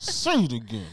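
squeezed out through clenched teeth; talking through teeth. Pretend. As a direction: push it through gritted teeth. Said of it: "I'm talking through my teeth".

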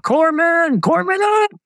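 high-pitched imitated yell for help; retold panicked high-pitched cry for a medic; calling for the medic. Marines calling for medic below.

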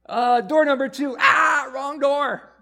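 high, loud buzzer-style call of wrong door; hammed-up host yelling that it's the wrong door; mock game show shout. Preacher playing a game show host for the congregation, loud mock shout.

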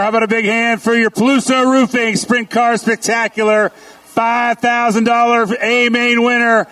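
lively track announcer voice; motorsport commentator. Acted. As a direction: hype up the crowd like a race announcer. Announcer hyping the crowd.

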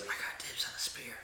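soft, whispered aside; horror speaking in your head. Acted. Game master as the horror claiming the spear, whispered. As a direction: whisper it slyly to the player. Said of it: "the horror speaks to you".

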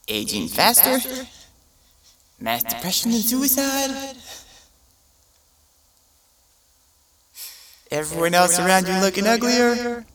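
high, bright list of horrors; cheerful ad with eerie menace. Retold or imitated. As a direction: list doom like good news. Ad listing side effects.

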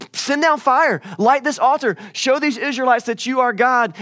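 high, loud desperate plea to a god; crying out to Baal. Retold or imitated. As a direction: call out loud and high, begging for fire.

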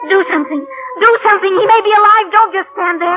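high, loud, panicked begging; desperate plea for help; frantic pleading. Acted. Pilot just crashed, pleading.

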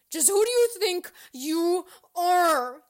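loud, high, stretched challenge; sneering. A narrator's reading. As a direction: sneer it at full volume. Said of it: "she sneered at him".

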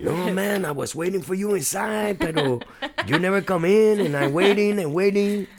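put-on hammy Spanish-accented tough guy. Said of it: "my Spanish accent comes out".

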